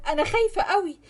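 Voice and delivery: high, pleading fear; frightened. Acted. Basma begging Ahmed to marry her, frightened.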